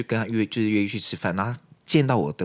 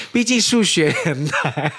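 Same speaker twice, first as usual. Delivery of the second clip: slow, laughing and louder; 8 semitones higher, 6 dB louder, much slower than usual. Real, not acted. Joking that math is hard, slow laughing. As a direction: draw it out through laughter.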